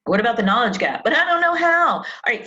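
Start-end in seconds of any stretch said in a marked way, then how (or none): 1.0-2.0 whiny excuse. Pretend. Mocking people's excuses.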